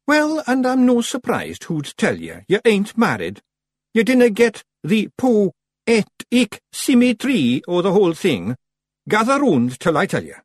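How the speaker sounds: high, loud, emphatic Scottish figure; Rob Anybody lecturing. A narrator's reading. Rob instructing unmarried Feegles, emphatic and exasperated. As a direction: lecture them hotly, pitched well up.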